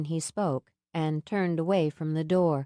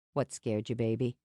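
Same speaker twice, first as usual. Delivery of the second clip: tender question; 7 semitones lower, 3 dB quieter than usual. A narrator's reading. Sam gently asks frightened Mia what scared her, low and tender. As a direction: lower and softer, tender. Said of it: "when he was being particularly sweet".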